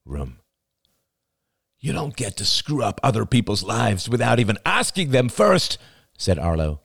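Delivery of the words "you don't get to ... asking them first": higher, heated, building in force; angry reproach. Acted. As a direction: scold a partner for overstepping. Acted quarrel, angry reproach.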